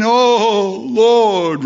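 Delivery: a hammed-up, weepy "Oh, Lord"; mock weeping plea. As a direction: ham up a tearful plea. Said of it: "be crying and weeping".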